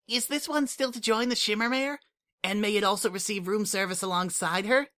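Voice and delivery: put-on high, bright-eyed childlike voice; a small changeling child asking a favour, eager; Kukri, eager changeling kid. A narrator's reading. Kukri asks the Shimmermare for room service, eager and high.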